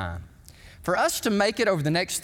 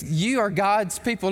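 usual speaking voice

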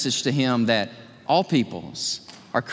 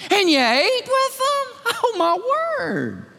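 mock-shocked accusation, pitched way up; outraged disbelief. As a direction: gasp it out like it's scandalous. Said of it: "Watch the exclamation point".

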